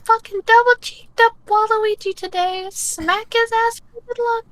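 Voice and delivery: high falsetto, mock Victorian vampire; Mint impression. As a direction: keep up a squeaky, hammed-up impersonation of a co-host. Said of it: "That is not what I sound like".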